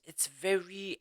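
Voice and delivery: usual speaking voice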